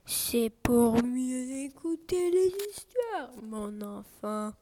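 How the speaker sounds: lent, doux, voix de fausse grand-mère; mielleux grand-mère loup. Acted. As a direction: endormir la victime avec douceur, lentement. (le loup, déguisé en grand-mère, répond à Lapin d'une voix lente et doucereuse)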